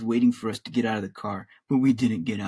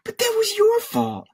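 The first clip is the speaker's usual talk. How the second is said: tight, high-pitched accusation; through clenched teeth; 4 dB louder than usual. A narrator's reading. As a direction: squeeze out the blame through gritted teeth. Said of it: "said through clenched teeth".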